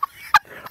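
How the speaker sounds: laugh. Real, not acted. Laughing at playing dumb.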